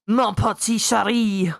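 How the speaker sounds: slow, put-on French charm; a hammed-up French accent; mock French accent. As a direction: purr it like a cartoon Frenchman. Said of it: "some French guy popping out".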